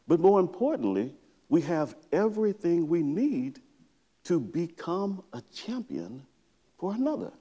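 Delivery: ordinary delivery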